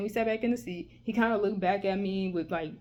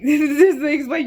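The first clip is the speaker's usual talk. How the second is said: loud, high laughing speech; words breaking up with laughter; real laughter; 6 semitones higher, 10 dB louder than usual. Real, not acted. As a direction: burst out laughing mid-explanation. Cracks up at own wording.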